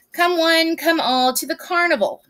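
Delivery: lightly voiced carnival crier; a hint of a barker's call; carnival sign announcer. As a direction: call "come one, come all" with a little lift. Reads the sign's call aloud.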